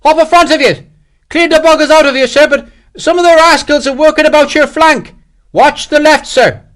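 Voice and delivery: high, loud, jaunty battlefield report; cheerful Irish shout. A narrator's reading. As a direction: holler it back as if enjoying the fight. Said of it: "The Irishman sounded indecently cheerful".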